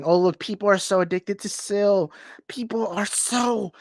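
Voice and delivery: high, drawn-out mocking voice; mocking imitation. Streamer mimicking another streamer's complaint about addicted fans, high and mocking. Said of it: "it kind of sounded like that".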